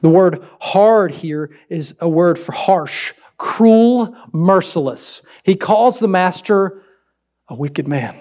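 ordinary delivery

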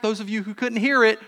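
ordinary talk

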